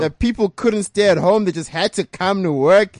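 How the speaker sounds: loud, high and hyped; big radio hype, slow on the build; hyped-up shout. Real, not acted. Host hypes up the speech.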